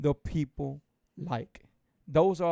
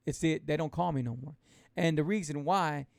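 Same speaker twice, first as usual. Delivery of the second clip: usual speaking voice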